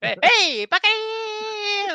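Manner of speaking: high, long drawn-out Buccaneers; mock shout at shoppers. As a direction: call out to fans walking past. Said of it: "stand out in front of fanatics".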